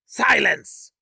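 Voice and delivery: high, loud furious command; angry snap. Acted. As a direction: snap it to silence the room. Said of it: "Zorath snapped".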